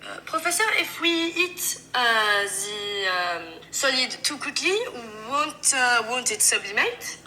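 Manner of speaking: French-accented English with hesitant pauses; French student groping for the English words. Shown as a demonstration. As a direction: put on a French accent, dragging out the filler sounds. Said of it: "French have a sound for their pauses".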